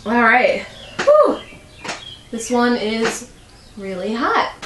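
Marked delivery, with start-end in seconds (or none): 1.0-1.4 hot sauce whew. Real, not acted.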